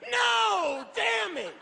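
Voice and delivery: over-the-top mock gunshot victim; fake getting shot. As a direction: play a melodramatic death scene. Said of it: "I just pretended to get shot".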